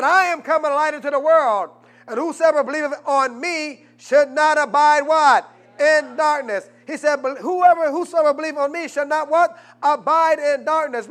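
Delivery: loud, high fervent preaching; fiery sermon delivery; preaching at full force. Acted. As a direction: thunder it from the pulpit.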